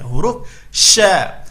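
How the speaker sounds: "sya" with lips relaxed, not rounded; correct sya. Shown as a demonstration. Tajwid teacher showing the correct sya. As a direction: say "sya" cleanly without pushing the lips out. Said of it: "jangan memonyongkan bibir menjadi sya".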